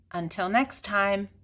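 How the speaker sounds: ordinary delivery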